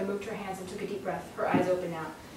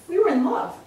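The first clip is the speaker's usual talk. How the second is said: put-on higher, louder, childlike protest; tearful insistence; 9 semitones higher, 8 dB louder than usual. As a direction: plead against the unfair. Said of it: "like a child remonstrating".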